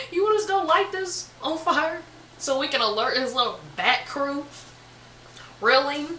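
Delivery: high, disbelieving question; can't believe what she asked; incredulous. Retold or imitated. Told to burn the bat, asks in disbelief. Reacting to grandma's order.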